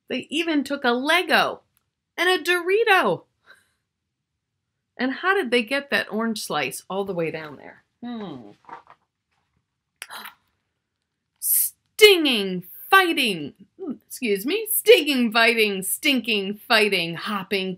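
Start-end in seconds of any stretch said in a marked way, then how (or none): none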